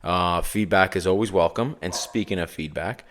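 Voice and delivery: usual speaking voice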